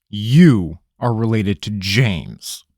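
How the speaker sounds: stunned question, stretched; incredulous. Acted. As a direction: draw out the disbelief. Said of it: "asked incredulously".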